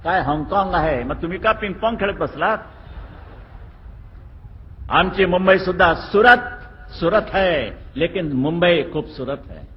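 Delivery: loud, high-pitched speech to a crowd; rally speech. Real, not acted. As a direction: fire up the crowd from the stage. Politician addressing a crowd.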